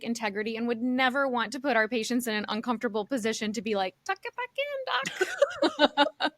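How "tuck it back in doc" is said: high, very slow mock plea to the doctor; mock client. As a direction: drag it out like an embarrassed client. Client voiced telling therapist.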